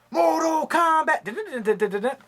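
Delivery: high, slow drawn-out announcer call; Mortal Kombat announcer. Retold or imitated. Vlogger showing off Blu-rays, imitating the Mortal Kombat announcer. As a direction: stretch it out like the game's announcer. Mimicking movie announcer shout.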